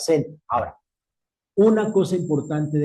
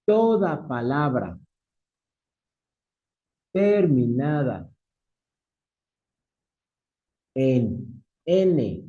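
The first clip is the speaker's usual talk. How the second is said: word by word with long pauses; measured, widely spaced phrases; slow dictation; slower than usual. Real, not acted. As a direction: dictate slowly for note-takers. Dictates the second rule.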